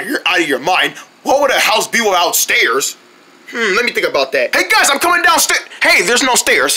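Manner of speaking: ordinary talk, nothing marked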